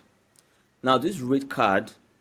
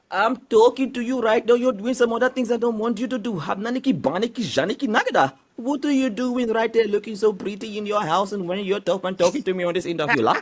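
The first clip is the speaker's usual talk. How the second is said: hammed-up Indian accent, pitched higher; Indian man impression; 9 semitones higher than usual. Shown as a demonstration. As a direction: play a flirty Indian man with a thick Indian accent. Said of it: "Please, can you talk like an Indian person".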